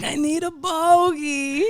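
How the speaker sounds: desperate smoker voice. Retold or imitated. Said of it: "He's like".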